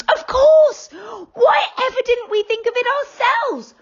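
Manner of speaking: loud, high eager exclamation; children crying out at the idea, loud and thrilled; excited shout. Acted. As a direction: shout it in delight. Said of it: "cried the children".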